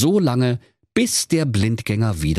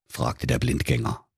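ordinary delivery

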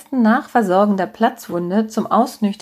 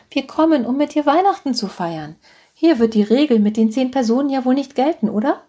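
a hint of an insistent mother, pitched higher; lightly voiced mother, high and coaxing; 6 semitones higher than usual. A narrator's reading. Mother announces Christmas at the hospital, higher and insistent. Mother overrides daughter's objection.

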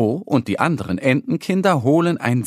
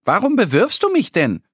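a bit cross; 10 semitones higher than usual. Acted. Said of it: "guckt ein wenig böse".

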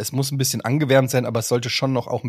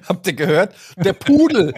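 raised, high-pitched call through laughter; insistent joke-teller; 6 semitones higher, 4 dB louder than usual. Acted. Teller repeats a joke nobody laughed at, mock-insistent. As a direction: push the failed punchline louder and higher. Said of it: "weil's keiner gehört hat".

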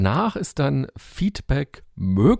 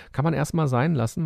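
normal talking voice

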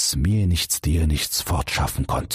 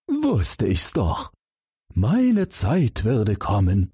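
slow, higher, smug frog; 5 semitones higher, slower than usual. A narrator's reading. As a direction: savour it like a frog whose moment has come. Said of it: "sagte der Frosch".

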